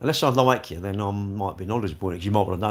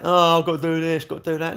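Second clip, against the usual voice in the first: higher-pitched imitated moan; mock whining; 5 semitones higher than usual. Imitating everyone who complains about work, mock whining. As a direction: whine it like a put-upon grumbler.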